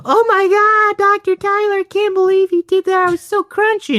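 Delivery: very high, loud mock female gushing; hammed-up astonished woman; shocked client. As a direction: squeal in amazed falsetto. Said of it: "They'll be shocked".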